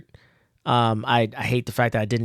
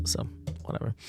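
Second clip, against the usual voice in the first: whispered aside; 14 dB quieter, faster than usual. Real, not acted. Dismissive aside about panels.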